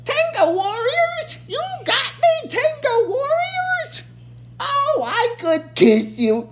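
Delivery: villain queen teasing her brother in a shrill put-on voice; sarcastic Rita. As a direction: drag out Tenga warriors with a sneer. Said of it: "kinda consider it a sarcastic remark".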